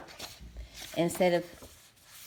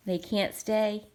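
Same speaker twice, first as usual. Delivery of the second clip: plain reading voice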